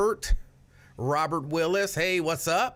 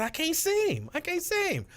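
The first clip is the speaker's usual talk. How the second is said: much higher, squeaky voice imitating a viewer; high squeaky quote; 13 semitones higher than usual. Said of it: "she said".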